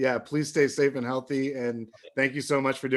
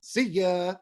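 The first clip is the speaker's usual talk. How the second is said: ordinary talk, nothing marked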